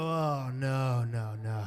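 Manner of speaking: very low, drawn-out dismay; dawning horror. Retold or imitated. Son fixing the chair realizes the truth, dawning horror. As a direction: sink down in pitch as the truth dawns.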